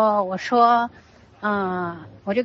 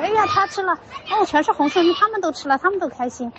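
high, bright-eyed game chatter, much higher; playful child voice; 9 semitones higher than usual. Retold or imitated. Son delighted the toys ate the red energy, high playful voice. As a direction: play the kid cheering that the toys ate.

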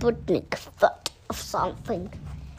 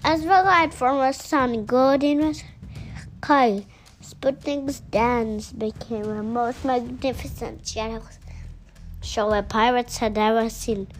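ordinary delivery

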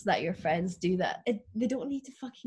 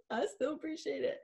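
high-pitched, lightly weepy imitation; mock tearful gratitude; 7 semitones higher, 3 dB quieter than usual. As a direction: squeak out thanks as if touched. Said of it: "crying when she got me it".

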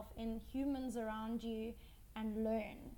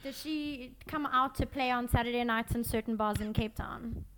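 loud teasing; 11 dB louder than usual. Real, not acted.

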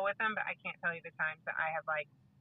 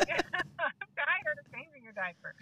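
higher, slow, fed-up groan of a parent; mock exasperated parent; 9 semitones higher, slower than usual. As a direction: drag it out like a worn-out mom.

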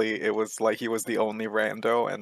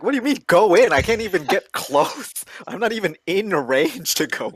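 high, loud indignant comeback; exasperated protest; 9 semitones higher, 7 dB louder than usual. Retold or imitated. Player answering the order to go in, exasperated protest. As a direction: protest it in mock disbelief. Acted protest to screaming teammate.

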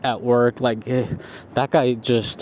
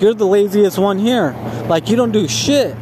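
higher-pitched mock yell at a lazy coworker; retold yelling; 6 semitones higher than usual. Coworker yelling at the lazy guy, higher mock yell. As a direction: rip into him, voice pushed up high. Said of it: "like, yelling".

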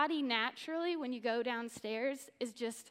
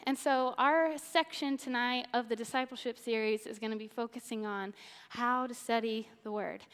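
ordinary talk, nothing marked

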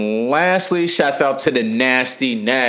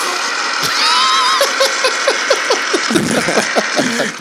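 high shrieking laugh. Real, not acted. Laughing at a friend's mistake.

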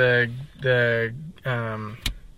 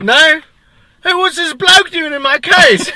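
very high, loud, laughing British yell; yelling across the room as a British bloke; British accent; 13 semitones higher, 11 dB louder than usual. Acted. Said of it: "They'd have to use the word bloke".

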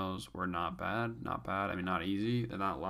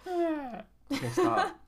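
laughing; 6 dB louder, much slower than usual. Real, not acted. Laughing back, high squeal.

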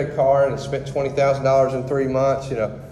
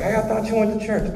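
higher, quick mocking friend; teasing friends; 8 semitones higher, faster than usual. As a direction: needle him like an old buddy. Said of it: "They're like".

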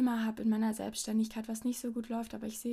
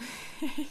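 verlegenes Kichern; 4 semitones higher than usual. Real, not acted. Verlegen über Intimes.

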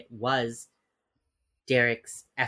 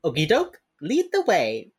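high, perky okie doke; mock chipper; 5 semitones higher, 5 dB louder than usual. Emily forced to take Waldy along, fake chipper. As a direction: brightly put on cheer, high. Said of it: "she turns around and goes".

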